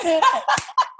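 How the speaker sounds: a quick, high burst of laughter; giggling at the memory; high delighted laugh. Real, not acted. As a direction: laugh at your own punchline. Laughs at her own story.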